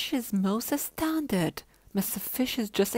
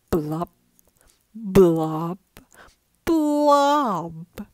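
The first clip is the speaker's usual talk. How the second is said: loud blop sounds; 10 dB louder, much slower than usual. A narrator's reading. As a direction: pop each blop louder. Loud blops building.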